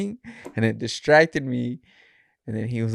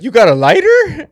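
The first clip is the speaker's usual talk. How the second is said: very high, much louder cop voice; mock cop; 14 semitones higher, 13 dB louder than usual. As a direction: pitch the cop way up and loud. Retelling the cop's question.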